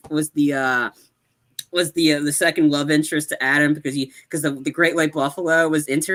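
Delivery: usual speaking voice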